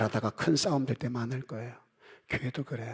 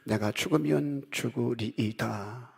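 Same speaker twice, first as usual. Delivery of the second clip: quiet, slow vow of resolve; slow solemn resolve; 6 dB quieter, slower than usual. Retold or imitated. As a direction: lay it down slowly, settled. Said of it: "어떤 과정을 거쳐서". (조용하고 느린 결연한 다짐)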